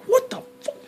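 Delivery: high pitched disbelief at the camera cutting; imitating his own outburst when the camera cut; mock outrage. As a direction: squeak it out in disbelief. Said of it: "I'm like".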